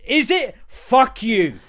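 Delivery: high, loud, outraged; mock furious retort. Angry retort at pupils.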